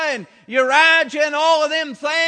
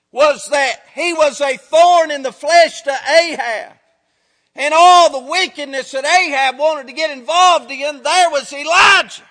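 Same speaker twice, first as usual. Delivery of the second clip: louder preaching, climbing to a shout on Elijah; building preaching; 4 dB louder than usual. Acted. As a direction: build the sermon to a cry.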